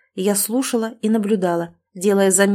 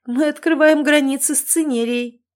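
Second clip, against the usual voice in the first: higher, amused pronouncement; wry smirk; 6 semitones higher than usual. A narrator's reading. Talbot explains the new uproar, wry smirk. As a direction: announce it with a smirk. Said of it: "с усмешкой изрёк Телбот".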